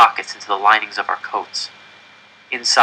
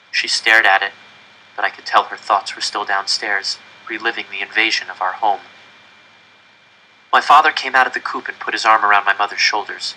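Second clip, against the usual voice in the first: ordinary delivery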